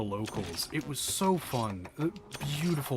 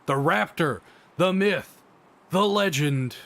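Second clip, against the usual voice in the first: "The raptor" proclaimed loud and falling, rising to a high, loud "the myth"; hype announcer; 5 semitones higher, 7 dB louder than usual. Acted. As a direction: build it up like a ring announcer. Hyping up the boss entrance.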